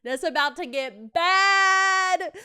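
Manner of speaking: loud, high drawn-out sinister tease; ominous warning. Retold or imitated. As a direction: warn darkly, dragging it out. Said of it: "It's like".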